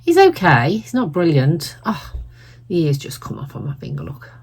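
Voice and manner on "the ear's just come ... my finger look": quick surprised whisper; hushed aside as the ear drops off. Real, not acted. Ear breaks off mid-video.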